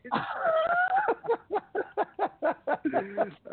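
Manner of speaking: real howl of laughter. Real, not acted. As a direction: burst out in a screaming laugh. Huge laugh at memory.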